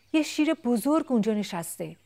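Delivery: loud, high warning cry; shouting chick. Acted. Chick shouting to baby elephant about the lion, loud and high. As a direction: yell the alarm into the ear. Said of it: "بلند دم گوش بچه فیل داد زد".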